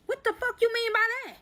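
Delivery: high-pitched, incredulous retort; indignant challenge. Retold or imitated. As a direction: demand an explanation, pitch shooting way up. Retold suspicious retort to the friend.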